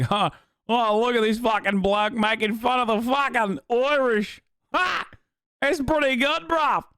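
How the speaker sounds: imitating an amused Aussie, pitched high; mock Australian. Streamer acting out comfortable Aussies mocking the Irish, put-on Australian accent. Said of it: "you Aussies, you think, like".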